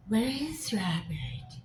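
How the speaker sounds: slow, whispered, seductive machine voice; sexy Alexa voice. Retold or imitated. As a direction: breathe it like a sultry voice assistant. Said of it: "how did she get that sexy voice".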